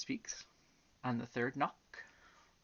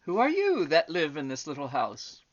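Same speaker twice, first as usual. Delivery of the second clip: louder, emphatic question; calling enquiry; 4 semitones higher, 10 dB louder than usual. A narrator's reading. Butterfly at the door asking who lives there, calling. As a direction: call it out, strong at the start. Butterfly calls to hidden elephant.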